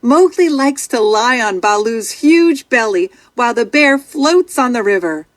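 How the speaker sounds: usual speaking voice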